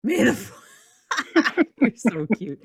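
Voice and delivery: high, loud laughing break; cracking up mid-phrase. Real, not acted. Laughs at Star Wars greeting.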